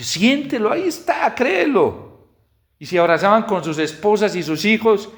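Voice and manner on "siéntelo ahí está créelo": very high, urgent cry of faith; fervent exhortation. Retold or imitated. Preacher praying over families at a congress, fervent exhortation. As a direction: call it out high, urging them to believe.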